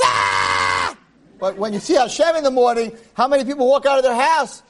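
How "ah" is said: loud low fan scream at a rock star; star-struck loud cry; fan's thrilled cry. Retold or imitated. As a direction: shriek like a starstruck fan. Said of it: "everyone's like".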